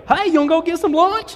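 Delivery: high, loud country twang. Pretend. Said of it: "then I step down and go".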